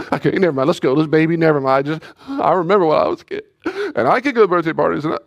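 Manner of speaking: high, loud, pleading give-in; mother caving in to her crying son, high and pleading; caving parent. Retold or imitated. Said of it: "I failed the test".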